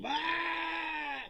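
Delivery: sudden high yell as he bursts into the Hulk; a quick mock roar of transformation.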